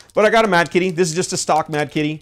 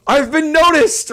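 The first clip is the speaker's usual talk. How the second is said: mock thrill; 13 semitones higher, 7 dB louder, slower than usual. Retells his own inner thrill.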